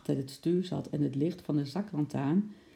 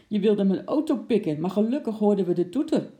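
louder, higher accusation; accusing; 5 semitones higher, 6 dB louder than usual. Acted. Wim accuses the man he tackled, loud accusing. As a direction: nail the thief.

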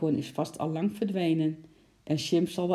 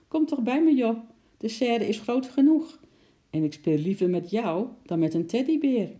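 much higher, louder cartoon chimp coaxing; chimp's imagined voice; 6 semitones higher, 5 dB louder than usual. A narrator's reading. Chimp Sjimp coaxes squirrel Eki to come, high, loud monkey voice. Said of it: "alsof hij Eki zeggen wilde".

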